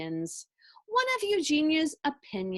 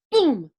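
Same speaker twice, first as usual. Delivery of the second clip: booming sound effect; 9 dB louder than usual. A narrator's reading.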